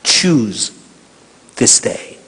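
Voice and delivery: low, hushed voice of God; God's voice. Retold or imitated. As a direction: speak as a quiet voice from above. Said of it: "하나님께서 그때 사실 영어로". (낮고 조용한 하나님의 음성)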